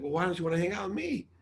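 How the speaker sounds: high, quick, affronted question; retold hurt protest. Asking best friend why he refuses to come, affronted. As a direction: ask in re-enacted disbelief.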